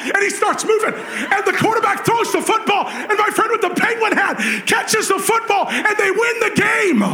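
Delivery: rising to a high excited shout; building excitement. Retold or imitated. Climax of game story.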